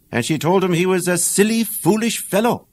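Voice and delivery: ordinary delivery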